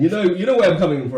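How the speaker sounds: loud, high and laughing; pushing it home, laughing; excited. Real, not acted. Laughing insistence.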